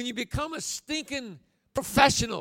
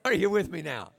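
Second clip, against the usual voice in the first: ordinary talk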